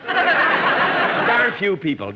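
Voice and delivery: loud, slow, high outraged repeat; indignant echo. Acted. Jack echoes Don's crack in loud outrage. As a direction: throw the insult back in disbelief.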